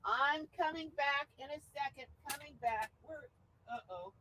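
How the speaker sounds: fading off, heard from across the room; walking away from the mic. Real, not acted. Host talking to viewers while walking off, far from mic. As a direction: call back while stepping away from the mic.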